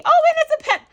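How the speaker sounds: high, loud squeal of delight; excited squeal. Real, not acted. Delighted find in gift box.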